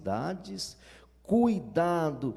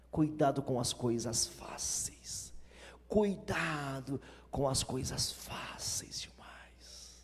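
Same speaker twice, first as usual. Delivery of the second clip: whispered warning; 4 semitones lower, 6 dB quieter, slower than usual. Acted.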